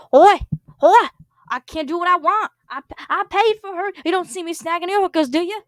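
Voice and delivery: high, loud, put-out protest; indignant row. Acted. Paul protesting to John that he paid for her. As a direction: argue back like an entitled brat.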